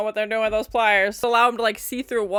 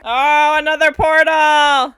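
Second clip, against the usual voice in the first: loud, high, drawn-out exclamation; excited surprise; 6 semitones higher, 9 dB louder, slower than usual. Real, not acted. Viewer spotting another portal opening, excited surprise. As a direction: exclaim at the sudden sight.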